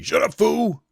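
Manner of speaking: a quick hammed-up tough-guy impression; Mister T. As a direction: bark it like a gruff tough guy. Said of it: "You know, like that".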